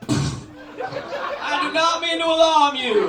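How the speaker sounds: loud, high, slow mock announcement; announcing shout. Comic imitating a man bursting through the train door, loud slow shout. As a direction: boom it out to the whole car.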